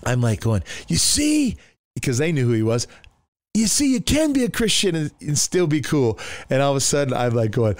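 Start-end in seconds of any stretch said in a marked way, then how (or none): 0.9-1.6 high, triumphant exclamation; acted vindication. Narrator to friends who knew the drummer, acted triumph. 3.6-6.2 starts very high, settles as it ends; acted vindication. Narrator telling friends Christians can be cool, acted triumph.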